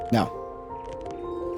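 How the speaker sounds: normal talking voice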